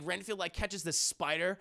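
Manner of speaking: ordinary delivery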